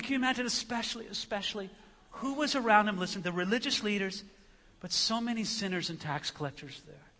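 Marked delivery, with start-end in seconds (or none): none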